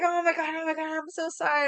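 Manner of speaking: high-pitched imitation of a gushing apology; apologetic mom. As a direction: imitate mom falling over herself to apologize. Voicing mom's flustered apology.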